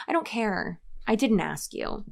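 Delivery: usual speaking voice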